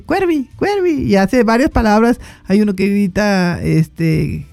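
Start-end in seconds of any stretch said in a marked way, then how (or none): 0.0-1.0 high, slow, sing-song crow talk. Retold or imitated. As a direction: imitate the crow calling its own name. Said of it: "y le dice".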